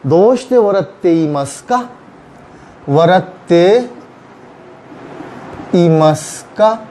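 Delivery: वरते ईमस का spelled out very slowly; slow formal demo. Shown as a demonstration. Teacher slowly modelling the polite question for students. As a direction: lay out each syllable of वरते ईमस का. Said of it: "formally हम कहेंगे".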